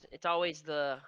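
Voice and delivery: normal talking voice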